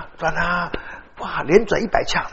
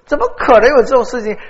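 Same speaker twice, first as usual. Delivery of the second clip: higher, louder, laughing questions; amazed laughing disbelief; 7 semitones higher, 6 dB louder than usual. Retold or imitated. Pastor retelling his amazed reply to the classmate, laughing.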